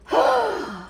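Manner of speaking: high, heavy acted sigh; big lovesick sigh; dramatic sigh. Acting out Romeo before his speech.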